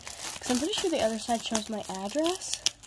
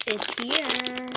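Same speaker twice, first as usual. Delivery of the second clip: normal talking voice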